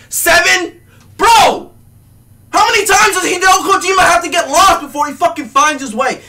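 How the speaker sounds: high, loud, fast yell; furious shout. Real, not acted. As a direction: let the outrage explode. Peak yelling rant.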